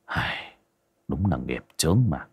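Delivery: a quiet resigned groan over fate; weary sigh. A narrator's reading. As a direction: breathe it out like a tired sigh. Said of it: "thở ra một hơi".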